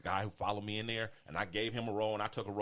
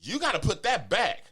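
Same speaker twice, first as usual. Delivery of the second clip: high, louder mock scolding; raised-pitch bossy imitation; retold cashier's order; 9 semitones higher, 6 dB louder than usual. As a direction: imitate a cashier laying down the law.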